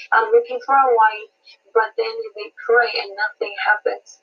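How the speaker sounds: usual speaking voice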